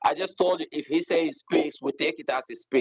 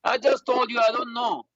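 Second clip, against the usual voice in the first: higher-pitched, exasperated insistence; 7 semitones higher than usual. Real, not acted. Debater fending off a repeated question, exasperated.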